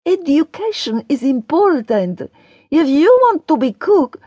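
high, insistent character voice; high-voiced earnest admonishing; lecturing Daria. A narrator's reading. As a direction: lecture the boy, pitched well up. Daria lectures Ben about school.

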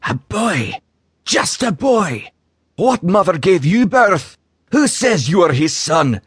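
high, slow taunting dragon voice; a wounded serpent sneering at the boy. Acted. Dragon Fafnir's dying taunt.

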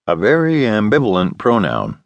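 ordinary delivery

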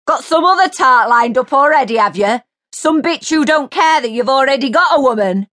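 high, loud taunting; jeering furious yell. A narrator's reading. Mary jeering down at Jace, furious. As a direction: jeer at him from above.

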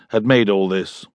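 ordinary delivery, nothing marked

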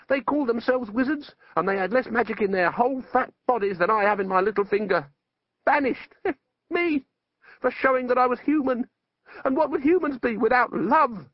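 high-pitched, indignant tirade; outraged, wounded wizard; ranting wizard. Acted. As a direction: pour out the grievance, pitch right up. Wizard rants at Death.

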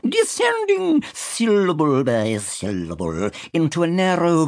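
slow quavering stage voice; thespian tremolo. Acted. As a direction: drag it out like an old ham actor. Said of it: "in his thespian tremolo".

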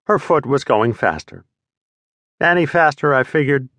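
ordinary delivery, nothing marked

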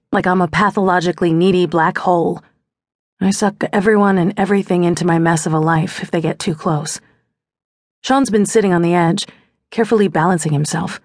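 ordinary delivery

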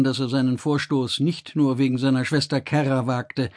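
ordinary delivery, nothing marked

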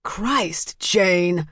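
mildly exasperated husband; understated bark. A narrator's reading. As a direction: scold the wife curtly. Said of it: "he barked to his wife".

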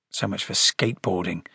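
low, soft, resigned mutter. Acted. Harry giving up on the skateboarding lesson, low mutter. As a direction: mutter it under your breath. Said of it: "muttered Harry".

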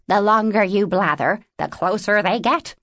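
lightly voiced raven, raspy and impatient; an impatient little raven hurrying them along; raspy raven warning. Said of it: "Tlittu rasped".

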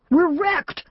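high panicked shout. A narrator's reading. Boy shouting to family after the car wreck, panicked yell. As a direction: yell it out in alarm, running in circles. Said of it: "Joe Allen shouted".

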